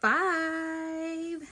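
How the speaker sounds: slow, bright storyteller intro; storyteller greeting little listeners; reading to children. Acted. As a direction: announce the story to kids.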